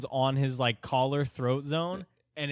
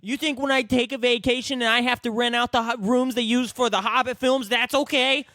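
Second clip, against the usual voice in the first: much higher, louder outraged parody, laughing; mock ranting short man; 12 semitones higher, 7 dB louder than usual. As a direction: yell the indignant question like the tiny furious man.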